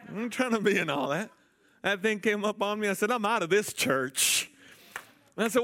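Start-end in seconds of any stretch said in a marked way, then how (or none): 0.1-2.9 high, loud imitated Jamaican accent; comic Jamaican retelling, pitched up. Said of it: "She had a Jamaican accent". 3.1-4.5 high, loud, drawn-out Jamaican imitation; hammed-up Jamaican sister; Jamaican accent. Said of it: "She had a Jamaican accent".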